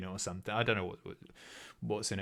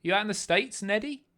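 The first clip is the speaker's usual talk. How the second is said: ordinary talk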